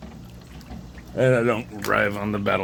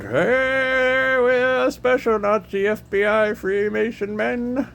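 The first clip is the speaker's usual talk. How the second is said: high, slow mock announcement; mocking tormentors' voice; 4 dB louder, much slower than usual. Mocking the men who cooked his brain, high slow voice. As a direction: ham up the pompous villains.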